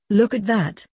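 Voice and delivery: a lightly excited exclamation; mild surprised delight at a picture; boy pointing out a picture, lightly excited; exclaiming. A narrator's reading. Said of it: "he exclaimed".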